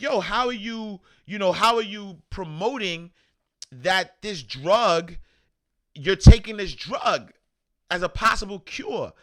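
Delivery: high-pitched, disbelieving questioning; incredulous challenge. Retold or imitated. Retells incredulous challenge to president.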